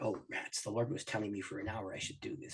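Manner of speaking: low, hushed inner voice grumbling to himself; a quiet disappointed aside to yourself; muttered thought. Retold or imitated. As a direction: think it under your breath, half-annoyed.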